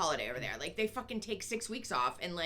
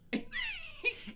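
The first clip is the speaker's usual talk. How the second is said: squeaky laugh; high giggle; 3 dB quieter than usual. Real, not acted. Laughing at bad teeth.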